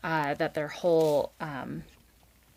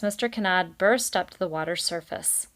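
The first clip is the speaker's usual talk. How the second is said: plain reading voice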